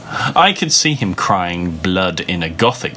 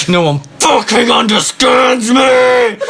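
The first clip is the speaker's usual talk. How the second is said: much higher, loud angsty yell; over-the-top teen tantrum shout; angry shout; 14 semitones higher, 4 dB louder than usual. Acted. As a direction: wail it like a misunderstood emo boy. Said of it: "he shouted angrily".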